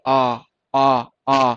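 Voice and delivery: long stretched vowel आ held out loud; demonstrating the long vowel; drawn-out aa. Shown as a demonstration. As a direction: sing out the long आ like a teacher. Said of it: "इसकी sound कैसे आ रही?".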